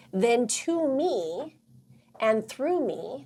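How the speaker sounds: slow, drawn-out ritual words; meditative recital. Acted. Coach reciting her vision board ritual, slow and meditative. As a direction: intone it like a guided meditation.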